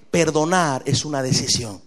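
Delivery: ordinary talk, nothing marked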